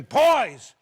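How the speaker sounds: loud, high angry yell; screaming father. Retold or imitated. Father bursting in on his drinking sons, screaming. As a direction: holler at the boys, scandalized. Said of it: "He come in the room screaming".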